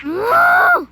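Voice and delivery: very high, loud scream of rage; furious scream. Real, not acted. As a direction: let out a real scream of anger. Gamer dies after threatening to cry.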